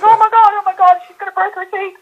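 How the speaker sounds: high, breathless retold alarm; retold panic. Watching a woman slip on the treadmill, high retold panic. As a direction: imitate her own panic watching the fall. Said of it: "I was like".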